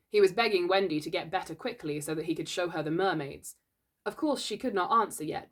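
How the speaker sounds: ordinary delivery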